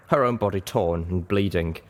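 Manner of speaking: plain reading voice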